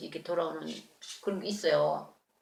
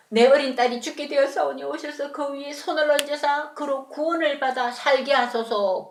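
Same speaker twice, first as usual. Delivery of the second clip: scripture reading; 6 semitones higher, 6 dB louder than usual. Acted. Reciting the Bible's wording.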